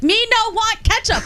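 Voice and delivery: high-pitched broken-English dad; mock caveman-like broken English; immigrant father. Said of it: "broken English".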